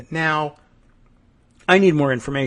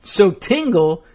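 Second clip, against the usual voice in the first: usual speaking voice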